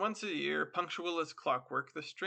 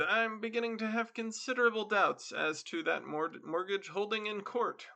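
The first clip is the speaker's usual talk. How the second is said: higher and firmer, gathering courage; bolder; 7 semitones higher, 3 dB louder than usual. Acted. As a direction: stand up to the stranger a little. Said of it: "got bolder".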